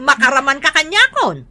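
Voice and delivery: normal talking voice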